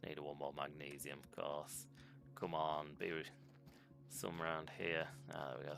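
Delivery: ordinary talk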